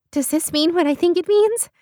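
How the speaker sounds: high, eager question; excited anticipation. Acted. Beth asks Lindsay about a wedding, hugely excited. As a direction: ask it bursting with excitement.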